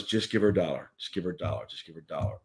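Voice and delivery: a hint of a murmured inner voice. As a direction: voice in her head, hushed and coaxing. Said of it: "the voice comes in my head".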